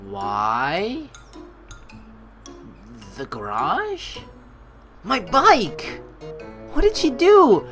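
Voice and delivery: ordinary delivery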